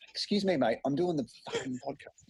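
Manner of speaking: aside to someone in the room; speaking off-mic to daughter; turning away from phone. Real, not acted. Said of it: "that was my daughter".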